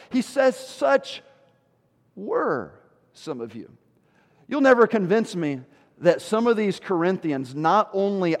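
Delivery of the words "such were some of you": pause, then a low, slow "were"; emphasis on were. Shown as a demonstration. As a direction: set "were" apart after a long pause. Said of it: "pick up on that word that he uses".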